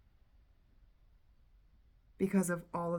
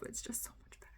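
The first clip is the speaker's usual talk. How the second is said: real welling-up, barely getting the words out; very soft, voiceless, overcome; choked with emotion; 2 semitones higher, 15 dB quieter, much faster than usual. Real, not acted. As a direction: voice drops away to a whisper. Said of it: "I'm getting all emotional".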